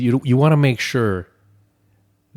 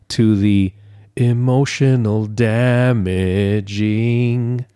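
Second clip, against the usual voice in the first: mock dramatic; 4 dB louder than usual. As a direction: stretch it out for comic doom. Mock dramatic fall from Disney magic.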